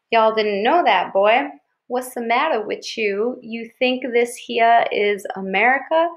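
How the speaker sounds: slow, hammed-up Southern hillbilly voice; Southern hillbilly accent. Dad goofing for the kids in a mock Southern hillbilly accent. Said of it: "say some more Southern style stuff".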